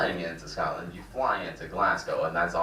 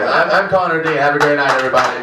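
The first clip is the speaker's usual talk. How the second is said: high, loud send-off to the crowd; loud sign-off; 8 semitones higher, 10 dB louder than usual. Real, not acted. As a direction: call out goodnight to the whole room.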